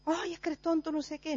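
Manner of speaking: mock impulsive scolding. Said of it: "¿Y tú qué haces?".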